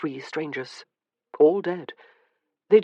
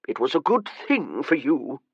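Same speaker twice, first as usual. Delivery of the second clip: put-on irritable old man. A narrator's reading. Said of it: "he said irritably".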